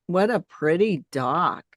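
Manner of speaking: normal talking voice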